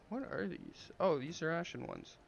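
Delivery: ordinary talk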